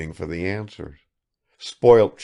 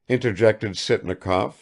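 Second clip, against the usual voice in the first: ordinary delivery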